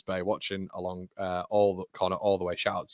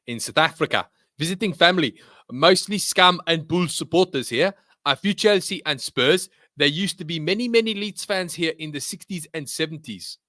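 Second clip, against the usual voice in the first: higher, louder put-on South African accent; 6 semitones higher, 4 dB louder than usual. Streamer reads a viewer's message from South Africa, South African accent. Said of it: "I don't think more South African accent".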